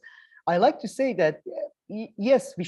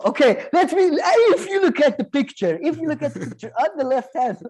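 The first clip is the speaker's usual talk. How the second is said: fast, higher, louder, explaining like a detective; eager, quick mock detective pointing at the photo; 10 semitones higher, 5 dB louder, faster than usual. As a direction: lay out the evidence like a sleuth. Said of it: "a witness to a detective".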